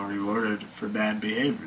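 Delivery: normal talking voice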